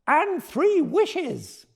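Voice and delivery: high, very slow drawn-out call; cheering cry. Acted. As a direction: stretch it out like a crowd's cheer. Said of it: "the dragons cried".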